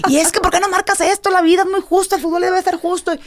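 high, loud, fast indignant complaint; mock protest at referee. Player reenacting arguing a call with the referee, high and indignant. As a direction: replay your old hot-headed self having a go at the ref.

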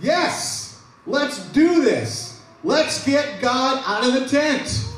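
high, slow, rallying cry; giving leaders cheering high and slow; excited crowd. Retold or imitated. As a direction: rouse them like a pumped-up crowd. Crowd eager to give.

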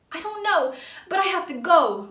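high, loud sputter; hurried sputtering exit line. A narrator's reading. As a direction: blurt it out in a rush. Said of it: "Percy sputtered".

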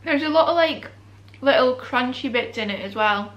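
speaking between bites; talking while chewing. Real, not acted. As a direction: mouth partly full of food. Seen 0: bit wrap at -3; mouth working, wrap held while speaking.

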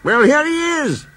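the boss arriving with good cheer; cheerful. Acted. The Fat Controller greets the worried engines, cheerfully. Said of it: "said a cheerful voice".

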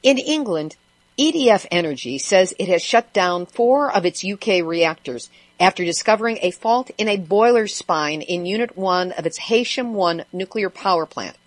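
usual speaking voice

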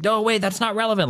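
high-pitched imitated interruption, no real shouting; mock scream-down. Debater cutting off an opponent who runs off, mock scream-down. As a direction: cut in with a high mock protest. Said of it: "is to scream them down".

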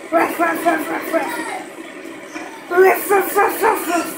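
rapid run of pretend barks; imitated dog bark. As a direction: bark like a dog in quick bursts.

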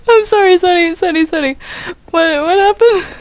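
high, giggly what; cracking up mid-question; laughing disbelief. Real, not acted. Caught off guard by a friend, laughing high. Thrown off by a joke.

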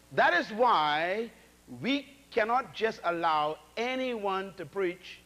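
each word set down slowly; slow it right down for weight; slow and deliberate. Real, not acted. Preacher warning who may preach, slow and deliberate.

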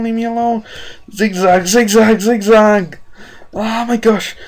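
high panicked pleading; frantic, scared begging; screaming at a spider. Real, not acted. Chased by spider in dark.

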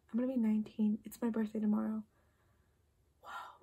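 usual speaking voice

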